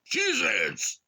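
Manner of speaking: high, loud shout; sudden high-pitched cheer; calling out. Pretend. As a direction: holler it like a fan chant. Calls out Packers fans' nickname.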